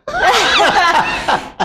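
high burst of laughter; real high-pitched laugh; amused laugh. Real, not acted. Laughs at the question.

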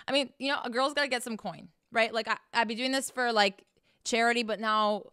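normal talking voice

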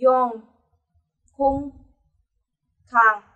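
"yong, khung, khang" each ending on nasal ng; one-by-one model words "yong", "khung", "khang" with final ng. Shown as a demonstration. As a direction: let "khung" and "khang" close into ng. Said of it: "đứng cuối mình đọc thành chữ ng".

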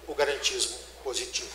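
usual speaking voice

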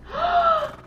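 sharp high gasp; shocked gasp. Real, not acted. Fan stunned by the sight of the rider, gasping.

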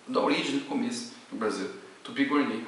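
normal talking voice

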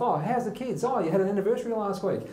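lightly voiced chatty old man; sharp and eager. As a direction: fire off the questions brightly. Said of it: "He's, he's firing off".

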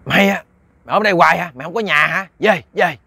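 loud, high, indignant demands; angry scolding. Acted. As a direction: scold hard as if driving someone home. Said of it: "Thì ông nạt nộ, ông kêu về".

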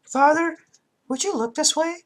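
put-on high child voice; girl voice. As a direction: call to father as Aya. Voices Aya.

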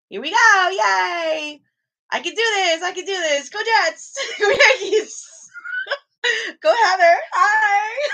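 high, loud cheering with laughter; excited self-cheer. Real, not acted. Cheering herself on at workout.

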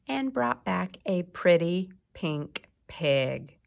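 slow, emphatic read-aloud; storybook reading. A narrator's reading. Teacher reads the farm story to a child. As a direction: read it slowly for little ears.

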